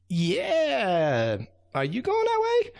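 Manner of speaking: very high, squeaky little ant voice; tiny ant voice. Acted. Tiny ants answering the raccoon in squeaky little voices.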